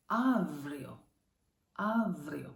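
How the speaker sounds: avryo with a long, strong opening AV; stress on first syllable. Shown as a demonstration. Teacher modelling a new word, avryo stressed on AV. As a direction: say avryo as AV-ree-o, punching AV. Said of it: "not avrio, not avrio".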